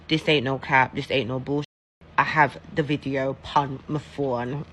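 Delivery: usual speaking voice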